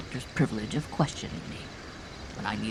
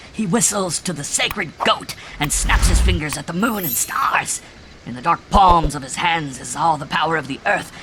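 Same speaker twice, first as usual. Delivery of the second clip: loud, higher, rapturous chant; crazy ecstasy; 5 semitones higher, 7 dB louder than usual. A narrator's reading. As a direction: proclaim it in mad religious rapture. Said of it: "a crazy ecstasy was in it".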